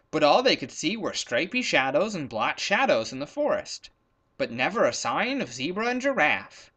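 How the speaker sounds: ordinary delivery, nothing marked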